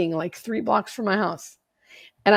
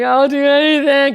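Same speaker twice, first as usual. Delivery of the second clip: loud, higher, breaking plea; desperate plea; 6 semitones higher, 8 dB louder than usual. Retold or imitated. Sobbing in the sponsor's car, loud desperate plea. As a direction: beg like there's nothing left. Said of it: "sobbing in her car".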